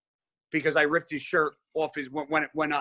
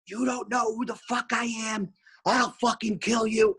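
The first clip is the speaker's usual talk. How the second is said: higher, strained threat from a hothead; raging kid; 5 semitones higher than usual. Retold or imitated. Gym kid threatens the speaker, higher and wild. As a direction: play the unhinged gym kid. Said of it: "he starts like".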